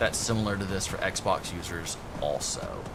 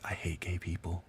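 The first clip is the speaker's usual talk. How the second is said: flat mumbled eye-roll line, much softer; imitating Mike; 5 semitones lower, 12 dB quieter than usual. Streamer mimics his friend's deadpan remark at drag shows, much softer. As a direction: toss it off under your breath. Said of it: "he'd be like".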